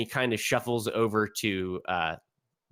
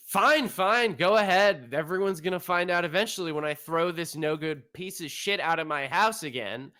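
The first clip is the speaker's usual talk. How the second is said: angry father; 7 semitones higher than usual. Acted. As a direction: throw up your hands and spit it out. GM voices angry father ranting.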